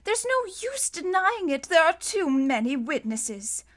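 sad resignation. A narrator's reading. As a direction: give up the argument sorrowfully. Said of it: "Justice Strauss said sadly".

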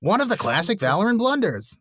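high-pitched comic exclamation; mock-villain parody. Riffing on a classic blunder with cohost, high comic voice. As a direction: crow it high, as a joke. Joking parody of a movie line.

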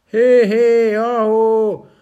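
very high, loud whooping call; hollering. Acted. Stone men holler after the prince, loud high whoops. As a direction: whoop after him like a mob. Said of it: "kiabáltak utána".

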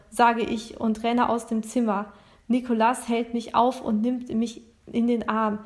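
ordinary delivery, nothing marked

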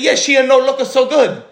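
put-on high, loud Italian accent punchline. Italian seller delivers the final punchline in accent. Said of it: "I like your Italiano voice".

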